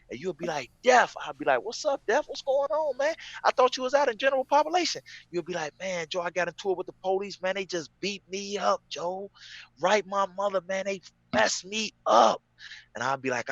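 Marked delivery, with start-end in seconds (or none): 0.8-1.1 high, louder call; calling own name. Retold or imitated. Friend answering through vent. 1.6-5.0 high fast calling voice; calling through vent. Retold or imitated. 5.8-12.4 higher voice, slowing at the end; beaten friend. Retold or imitated. Beaten friend through vent.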